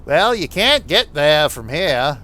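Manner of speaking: loud, slow, nasal New England accent; drawn-out New England catchphrase, hammed up. Shown as a demonstration. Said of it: "the New England accent".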